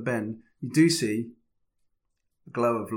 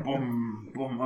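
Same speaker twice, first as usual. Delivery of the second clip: footstep sound effect; 3 semitones lower, 6 dB quieter, slower than usual. Retold or imitated. Mocks loud footsteps.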